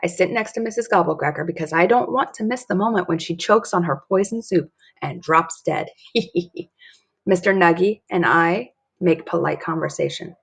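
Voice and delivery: ordinary delivery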